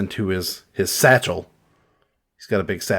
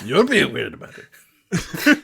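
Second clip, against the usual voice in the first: slow, laughing taunt; laughing slow mimicry; laughing mock; 5 semitones higher, slower than usual. Real, not acted. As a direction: drag it out, giggling. Mocking while laughing.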